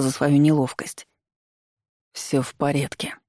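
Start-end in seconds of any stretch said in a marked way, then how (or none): 2.1-3.2 soft grudging words through teeth; through gritted teeth. Acted. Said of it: "сквозь зубы процедил комиссар".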